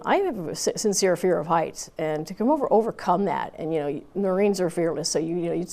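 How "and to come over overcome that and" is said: ordinary talk, nothing marked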